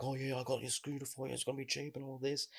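high, softened sales-pitch imitation; imitated scammer. Imitating scammers renting out bad scooters to tourists, high-pitched patter. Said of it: "one of the scams where they're like".

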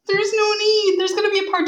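high laughing shout. Real, not acted. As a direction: squeal it out through laughter. Friend teases about apple cutting.

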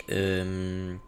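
ordinary talk

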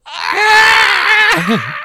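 long, loud, very high imitated squeal; shrieking laugh. Stoned reader cracking up at bosom, shrieking high. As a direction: burst into a high, drawn-out mock shriek. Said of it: "And he starts laughing".

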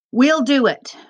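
urgent surrender. A narrator's reading. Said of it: "Mohammed told the guards".

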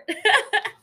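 giggling burst; laughing. Real, not acted. Laughs at own recap.

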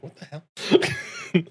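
slow, high "what the hell"; drawn-out disbelief. Retold or imitated. Mimics a confused gamer.

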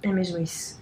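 whispered deadpan agreement; just breathe it, dry. Real, not acted. Agreeing nobody cares about her friend, whispered.

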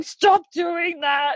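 high, drawn-out mock whine-yell; mad little boy. Host mimics her son objecting to breathing, mock mad. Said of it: "sometimes it actually makes him mad".

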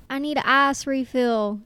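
slow Southern drawl, "ice" as "ahs"; mimicked Southern coworker; imitated Southern accent. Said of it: "she'd be like".

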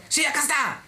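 high-pitched, insistent customer voice; high mock-belligerent retort; belligerent caller. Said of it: "seguía con esa actitud beligerante".

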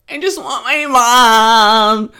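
put-on loud, slow whiny little-kid wail; like a four-year-old child. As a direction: whine it like a small child who wants mom. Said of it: "like a four-year-old child".

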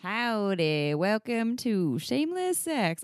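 a hint of a Southern host; mock Southern accent. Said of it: "I'm not gonna talk in that accent".

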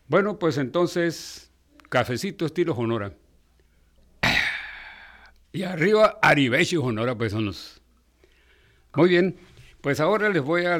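hammy stage actor. Acted. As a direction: play it to the crowd like a ham. Toasting paisanos with showy exclamations.

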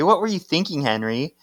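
high-pitched retold scolding; acted exasperated demand; mock yelling. Paige confronts Henry over the risk, mock yelling. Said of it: "Paige is yelling at Henry".

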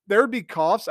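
usual speaking voice

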